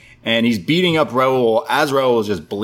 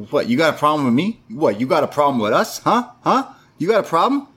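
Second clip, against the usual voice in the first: higher, pushy imitating of a cocky challenger; mock tough-guy taunt; 6 semitones higher than usual. Podcaster imitating Everrise daring Raquel to hit him, mock taunt. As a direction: goad them to take a swing, hammed-up. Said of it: "And they go".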